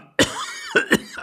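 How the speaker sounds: wheezy coughing fit; old man's cough. Acted. Old husband interrupts his own story, coughing. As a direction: cough helplessly mid-sentence.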